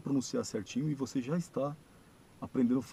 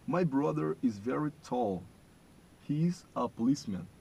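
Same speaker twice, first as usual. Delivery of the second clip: slow, louder English read; slow model sentence; 3 semitones higher, 5 dB louder, slower than usual. Shown as a demonstration. Teacher reading the third sentence aloud, slow. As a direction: lay each word out for repeating. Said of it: "vocês vão repetir o modo como eu falo".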